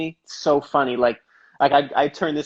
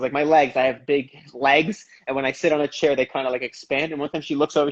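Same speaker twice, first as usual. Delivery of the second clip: ordinary delivery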